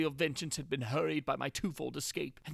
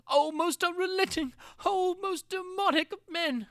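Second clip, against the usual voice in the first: much higher and louder, a cry of outrage and terror; anguished outcry; 12 semitones higher, 5 dB louder than usual. A narrator's reading. Prisoner crying out against his torturers, anguished high wail. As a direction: wail it at the ceiling like a curse.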